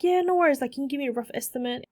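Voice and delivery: quick friendly client voice; client on the phone. Retold or imitated. A lightly voiced client asking for an estimate. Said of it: "she's like".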